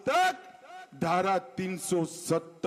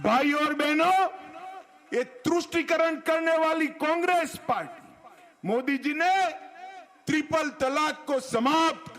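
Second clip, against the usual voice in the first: booming political rally speech; 6 semitones higher than usual. Real, not acted.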